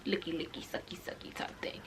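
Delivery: very soft, dropping to a whisper; barely audible coy aside; hushed aside. Real, not acted. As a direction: let it sink into a whisper. Coy aside about sexual act.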